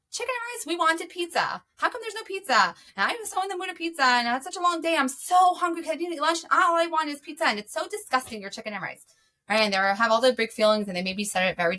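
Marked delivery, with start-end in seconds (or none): 0.1-9.0 put-on high, whiny child griping about supper; whiny kid. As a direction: whine it like a hungry kid who wanted pizza. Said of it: "they come in and say".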